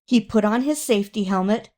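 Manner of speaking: plain reading voice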